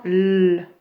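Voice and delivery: L held long as "lll"; isolated L sound. Shown as a demonstration. Teacher telling pupils where to mark, long L. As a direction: lengthen the bare consonant L. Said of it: "là où on entend L".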